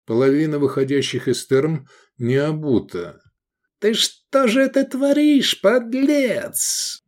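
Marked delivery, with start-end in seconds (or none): 3.8-7.0 thundering, high-pitched outrage; angry matron. A narrator's reading. Matron confronting a man from behind, furious. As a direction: draw it out in high, furious indignation. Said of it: "возгремел за его спиной гневный голос".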